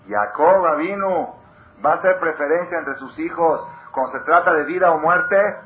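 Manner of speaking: full-force sermon climax; preaching outcry. Acted. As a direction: thunder it at the congregation.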